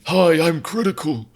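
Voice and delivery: slow mock imitation of Critical's accent; slowly ape Critical's accent; a light parody of Critical's accent; his accent. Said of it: "I so clearly nailed his accent".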